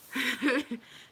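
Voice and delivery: short loud laugh. Real, not acted. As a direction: burst out laughing briefly. Amused by own compliment.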